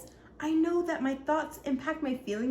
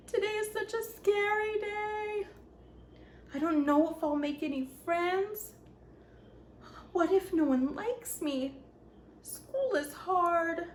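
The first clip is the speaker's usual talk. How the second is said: nervous worrying; 6 semitones higher than usual. Acted. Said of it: "I woke up feeling nervous and scared".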